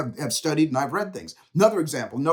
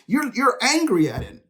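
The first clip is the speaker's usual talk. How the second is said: retold accusation; 8 semitones higher than usual. Friend calls him out, retold.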